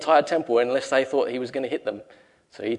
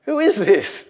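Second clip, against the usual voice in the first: amazed disciples; 8 semitones higher, 6 dB louder, slower than usual. Retold or imitated. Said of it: "they said to each other".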